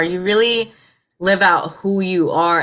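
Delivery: usual speaking voice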